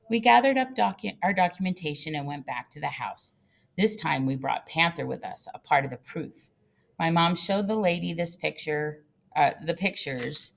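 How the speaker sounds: ordinary delivery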